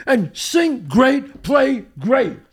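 starry-eyed praise; gushing. Real, not acted. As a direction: savor each word. Gushing about her singing.

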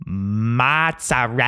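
drawn-out, overdone Italian MAAT-sa-REL-la; milk every syllable in a fake Italian accent; douchey Italian accent. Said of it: "say it super douchey".

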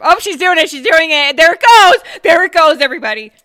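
loud and high, cheering her on; excited cheering. Real, not acted. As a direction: hype it up like a sports announcer. Cheering friend taking a shot.

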